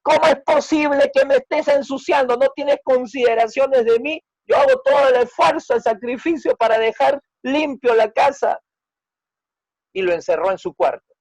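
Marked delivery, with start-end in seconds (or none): 0.0-8.6 high, loud furious scolding; mother scolding her son for the muddy feet; angry mother. Acted. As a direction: let the mother's built-up rage explode. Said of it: "ella le abofeteó enfadada".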